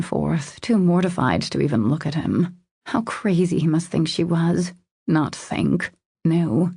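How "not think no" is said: ordinary delivery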